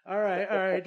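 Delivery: loud, high playful teasing. Real, not acted. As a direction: tease him with a big grin. Joking hint about free copy.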